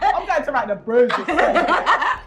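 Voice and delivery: laughing through words; giggling while talking. Real, not acted. Laughing after pun.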